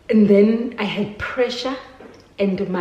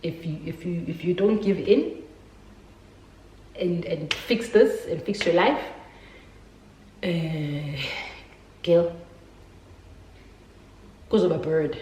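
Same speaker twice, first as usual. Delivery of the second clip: gentle warning; 4 dB quieter than usual. Retold or imitated. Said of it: "my mom was like".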